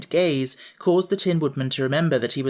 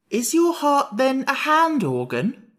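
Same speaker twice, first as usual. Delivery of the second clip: slow, much higher wondering Pumpkinhead; curious; 10 semitones higher, 4 dB louder, slower than usual. Acted. Pumpkinhead puzzling over the heart, curious. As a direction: ask it wide-eyed and innocent. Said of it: "asked the Pumpkinhead curiously".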